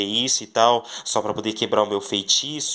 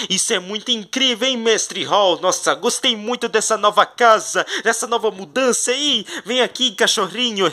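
higher, lively voice of a delighted figure; excited character voice; 9 semitones higher than usual. Pretend. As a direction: gush over the new house. Character admiring the new house.

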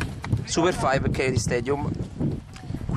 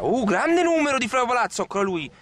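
excited; 10 semitones higher, 3 dB louder than usual. Acted. Excited commentator calls skill.

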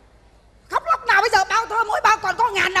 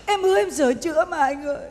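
normal talking voice

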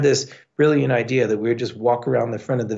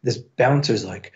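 usual speaking voice

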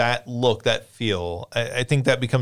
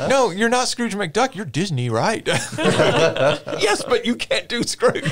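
laughing scolding; 9 semitones higher, 3 dB louder, slower than usual. Pretend. Disney exec scolding Cage, laughing.

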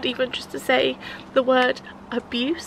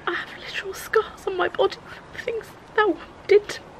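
tearful whisper; 9 semitones higher than usual. Real, not acted. Tearful retelling of the abuse.

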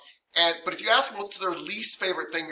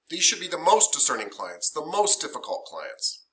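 normal talking voice

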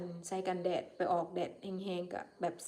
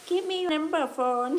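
put-on much higher, slow, loud, Thai-accented broken English; 7 semitones higher, 5 dB louder, much slower than usual. Clerk mimicking asking a foreigner for a phone number, in hammed-up Thai-accented English. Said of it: "ขอ number เขาอะ".

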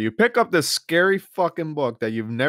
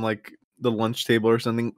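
usual speaking voice

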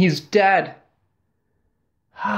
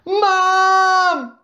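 high, loud cry for Mum; panicked call; 4 dB louder than usual. A narrator's reading. Boy calling Mum as the squid wrecks the street.

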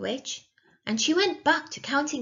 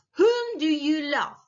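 high, loud interrogation; demanding witch; 6 semitones higher, 5 dB louder than usual. A narrator's reading. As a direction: press hard for an answer.

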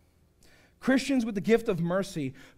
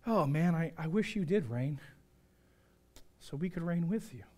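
softer mock wistfulness; sarcastic; 3 semitones lower, 5 dB quieter than usual. Preacher voicing Paul's wish, quietly sarcastic. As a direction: drop to a quiet, dry wish. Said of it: "He's being sarcastic".

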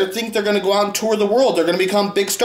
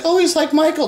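high gushing mock fan; 8 semitones higher than usual. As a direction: squeak it out like a duped fan. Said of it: "people will be like".

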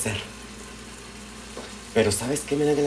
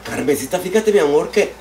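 delighted gasp; 8 semitones higher than usual. Real, not acted. Gasps, greeting a fan.

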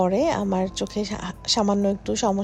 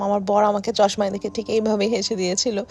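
ordinary talk

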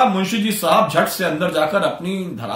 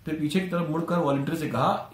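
ordinary delivery, nothing marked

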